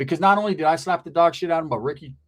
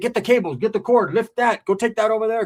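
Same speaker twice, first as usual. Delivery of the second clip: bossing around; 6 semitones higher, 3 dB louder than usual. Retold or imitated. Ordering his cousin around at work.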